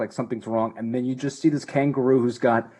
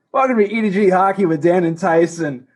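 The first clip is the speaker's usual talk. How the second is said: announcer voice; 8 semitones higher, 8 dB louder than usual. Acted. Show sign-off announcement.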